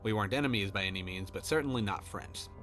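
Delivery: ordinary delivery, nothing marked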